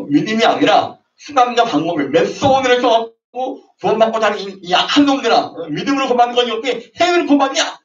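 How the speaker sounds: high, loud accusing tirade; mock condemnation. Presbyterians denouncing Methodists, high loud accusing voice. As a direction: condemn them as wicked ones.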